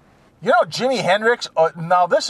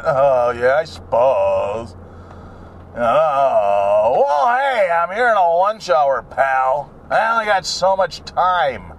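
snooty gym hog. Retold or imitated. Hog to man asking to work in, condescending sneer. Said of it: "they go".

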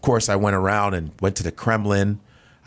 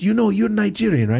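curious Russian host; 11 semitones higher than usual. Retold or imitated. Russian host eagerly asking the comedian if he's Nigerian. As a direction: ask it high and eager like a foreign host.

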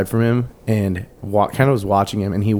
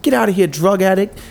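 high mock scolding; high-pitched imitation of an affronted woman; indignant old lady; 10 semitones higher than usual. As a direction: shoo him off as an offended woman. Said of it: "and she's like".

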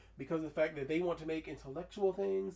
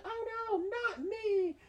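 squeaky mock-horrified mother, much higher; much slower than usual. Retelling the mother spat at by her son, high mock wail. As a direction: play the mama as a shrill cartoon victim. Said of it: "and she's like".

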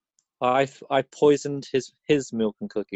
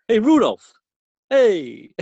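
louder, high call to Rudolph; mock Santa calling; 8 semitones higher, 6 dB louder, much slower than usual. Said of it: "Santa going".

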